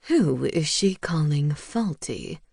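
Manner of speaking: a sulky little owl taking offence; indignant owl. A narrator's reading. Owl familiar speaks.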